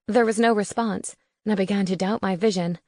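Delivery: ordinary delivery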